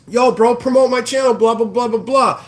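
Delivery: hammed-up pushy bro; mock pushy guy. Streamer imitating people who ask him to promote their channel, mocking bro voice. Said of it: "be like".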